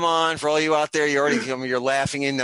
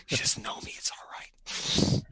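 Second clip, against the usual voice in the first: whispering; 7 dB quieter, much slower than usual. Real, not acted. Mock-consoling himself after joke.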